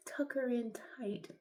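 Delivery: soft high whisper, slow; hushed bedtime whisper. Real, not acted. Whispering while applying strip.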